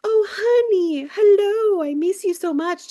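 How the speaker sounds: high, drawn-out, loud doting greeting; mock-sweet cooing to a child; put-on sweetness. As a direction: gush like a parent playing to the camera. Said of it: "'cause he's on camera trying to be like".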